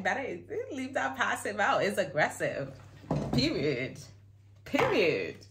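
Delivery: loud, high, no-nonsense correction; sassy imitation of a firm comeback; emphatic mock retort. Emphatic retelling of woman's retort.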